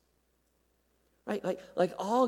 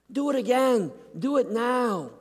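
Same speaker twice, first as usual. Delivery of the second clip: higher, louder desperate plea; pleading cry; 7 semitones higher, 4 dB louder than usual. Acted. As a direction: beg the Father to act now. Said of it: "That's his heart".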